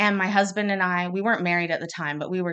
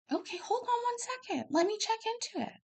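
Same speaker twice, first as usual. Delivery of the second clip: soothing doctor; 9 dB quieter than usual. Retold or imitated. Doctor calms the upset mother.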